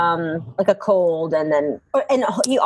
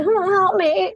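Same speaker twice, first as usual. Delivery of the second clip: higher, whiny-playful mock cry for help; mock plea for help; 10 semitones higher, slower than usual. Daughter calling mom over the doctor's bill, mock pleading. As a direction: plead like a helpless kid, hammed-up.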